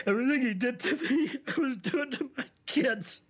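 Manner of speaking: high-pitched, sobbing realization; sobbing breakdown. Acted. Client realizes he became his father, breaking down in sobs. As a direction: break down crying as the words come out.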